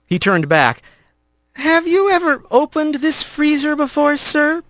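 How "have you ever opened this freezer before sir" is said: put-on higher, nervous young voice; a boy rat asking carefully. A narrator's reading. Young rat questioning the stern father, nervous and high.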